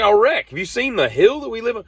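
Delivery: high, loud alarmed protest. Retold or imitated. Warning roommate about the hill, high alarmed protest. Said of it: "I was like".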